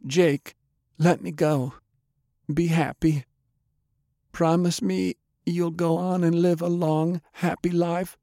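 high-pitched woman's voice, sorrowful; dying woman, pleading. A narrator's reading. Undead Delilah asking Jake to let go, pleading. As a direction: plead gently in a high female register.

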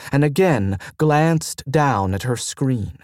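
usual speaking voice

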